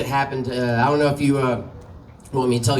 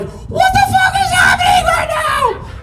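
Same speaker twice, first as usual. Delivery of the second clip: much higher, louder, slow shrieked disbelief; shocked scream; 5 dB louder, slower than usual. Retold or imitated. Evan sees the 'dead' friend pop out of the closet: shocked screaming. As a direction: shriek it like the dead man just walked in. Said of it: "And then Evan's like".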